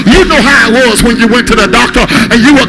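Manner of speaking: loud, high, driving sermon voice, music behind; rising gospel preacher cadence, loud and high; full-force preaching. Acted. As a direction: preach it hard to the congregation.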